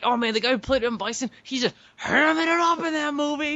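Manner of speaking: high-pitched, hammed-up impression of a friend; imitating a friend. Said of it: "that's what you used to s-, you said, like".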